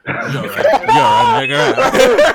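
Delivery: very high and loud, cracking up mid-line; laughing through it. Pretend. Breaking into laughter while redoing the line for friends. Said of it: "I'm the only one who can actually do that accent".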